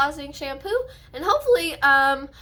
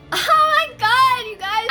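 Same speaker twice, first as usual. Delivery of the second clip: excited gasp; 9 semitones higher, 6 dB louder, slower than usual. Real, not acted. Sudden excited exclamation.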